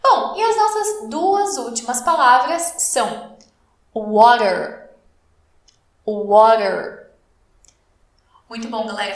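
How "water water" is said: "water" with the T flapped like a quick R, "wɔɾer". Shown as a demonstration. Teacher shows students "Water" with a flapped T. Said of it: "esse T aqui tem um som meio estranho".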